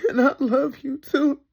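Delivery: tearful, breaking on too; crying. Real, not acted. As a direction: voice cracking upward in tears. Mother tearfully addressing her children.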